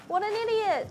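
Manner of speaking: high-pitched cry at your own blunder; exasperated exclamation. Real, not acted. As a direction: squeak it out at yourself.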